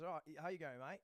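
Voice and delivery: lightly put-on cheery Aussie greeting; casual mock-Australian hello; cheerful Australian accent. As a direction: mimic a breezy, friendly Australian. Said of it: "very cheerful Australian accent".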